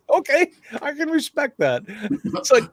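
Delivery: high, haughty cat with a laugh; a snooty cat humoring a human; aloof cat voice. Pretend. Host voices an aloof cat dismissing its owner. Voicing the cat's attitude.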